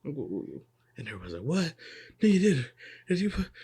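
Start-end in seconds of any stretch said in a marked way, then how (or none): 1.4-3.5 high, startled disbelief; surprised. Retold or imitated. Everyone gasping at the old man's new power, high and startled. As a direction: blurt it out in shock, pitch way up. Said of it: "all surprised and shit".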